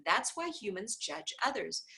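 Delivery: ordinary delivery, nothing marked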